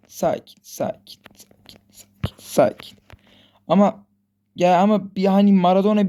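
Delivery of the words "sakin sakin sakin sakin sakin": hushed self-soothing whisper; whispered calm down. Real, not acted. Gamer soothing himself before the pass, whispering. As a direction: whisper it to yourself, slower.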